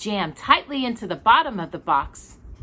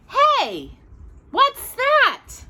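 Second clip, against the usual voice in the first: surprised; 4 dB louder, much slower than usual. Acted. Walter spots mysterious box.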